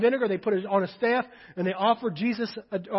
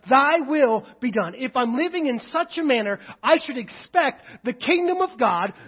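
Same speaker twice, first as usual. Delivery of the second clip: loud, raised voice, driving home each phrase; full-force preaching; 5 semitones higher, 7 dB louder than usual. Real, not acted. Preacher urging the congregation toward kingdom results, full-force preaching. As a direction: preach it hard with stretched key words.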